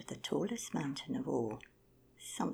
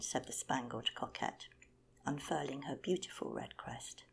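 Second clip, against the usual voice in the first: ordinary delivery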